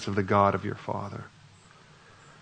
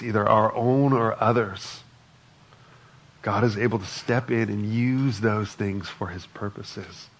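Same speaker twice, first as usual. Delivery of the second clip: ordinary talk, nothing marked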